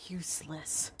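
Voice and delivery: whispered scorn; contemptuous whisper. Acted. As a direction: breathe it out in disdain. Master dismisses the pair.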